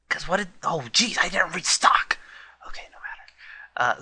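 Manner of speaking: whispered oops; whispered dismay. Real, not acted. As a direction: hush it in dismay. Realizes he forgot items.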